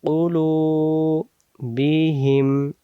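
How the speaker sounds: কুলুবিহিম drawn out slowly; slow recitation. Shown as a demonstration. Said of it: "আলা". (ধীরে টেনে পড়া)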